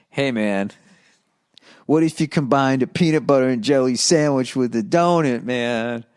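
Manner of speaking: lightly voiced dazed stoner musing; a hint of a spaced-out pothead; stoned guy. Host imitating the stoned fan dreaming up a donut, lightly dazed stoner. Voicing the high letter writer.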